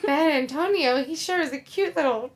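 put-on high, loud swooning old lady voice; imitating grandma. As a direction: gush like a smitten grandma. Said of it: "my grandma was like".

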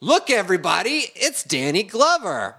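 retold high-pitched celebrity call; excited announcement. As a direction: call the room's attention, pitch jumping up. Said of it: "And I said".

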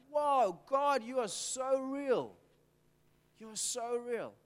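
acted-out awestruck prayer; awed amazement. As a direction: marvel at God in astonishment. Acting reaction to answered prayer.

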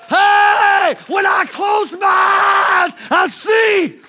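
loud, high, shouted sermon climax; full-force preaching. Real, not acted. Preacher shouting to the congregation in full force.

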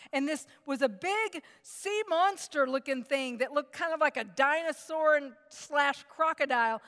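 ordinary talk, nothing marked

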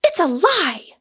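high, loud denial; furious cry. A narrator's reading. Rosamond denies Michael's charge about money, furiously. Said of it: "cried Rosamond furiously".

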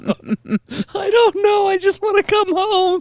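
very high, drawn-out mock wail; imitating a friend sobbing on the phone; crying breakdown. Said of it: "has this breakdown where she finally lets it all out".

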